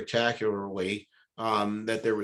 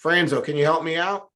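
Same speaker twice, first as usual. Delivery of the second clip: loud, higher shout up from the pit; stuck and hollering upward, pleading; calling up for help; 6 semitones higher, 6 dB louder than usual. Retold or imitated. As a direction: hail a friend walking past above. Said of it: "He goes up".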